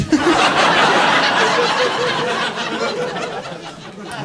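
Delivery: high, long falling laugh. Real, not acted. Comic laughing at the wet line, high falling laugh. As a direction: let the laugh climb high and fall away. Cracks up at own story.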